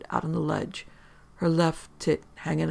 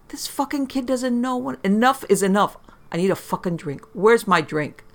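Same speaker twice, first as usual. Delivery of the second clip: put-on drunken rant; 6 semitones higher, 4 dB louder than usual. Drunk mother rants at kid.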